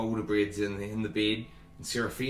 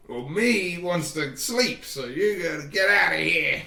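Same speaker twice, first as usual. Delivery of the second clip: lightly voiced annoyed sleeper, raised and louder; grumpy Boulder Bread; 5 semitones higher, 5 dB louder than usual. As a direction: send them away with mild mock irritation. Sleepy Boulder Bread orders him out.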